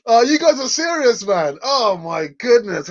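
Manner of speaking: high, loud, laughing amazement; laughing disbelief. Real, not acted. Reacting to chat being serious, laughing.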